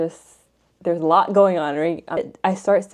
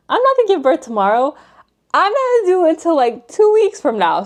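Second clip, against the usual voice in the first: exasperated disbelief; 10 semitones higher, 4 dB louder than usual. Retold or imitated. Dismissive quote to mom.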